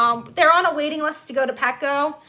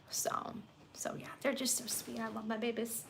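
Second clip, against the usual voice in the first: soft whispered trailing off; very soft, half-whispered fondness; 3 semitones lower, 18 dB quieter than usual. Real, not acted. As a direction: hush it as the kittens calm down. Hushed while kittens settle.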